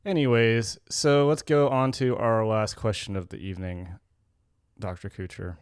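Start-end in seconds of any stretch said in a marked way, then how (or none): none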